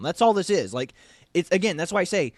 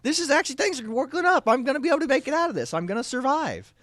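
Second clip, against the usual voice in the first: much higher, fast, excited bird voice; a sparrow in the cow patty suddenly full of hope; happy chirping sparrow; 9 semitones higher than usual. Acted. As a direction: chirp it out like a little bird who's sure he'll make it. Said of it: "He's like".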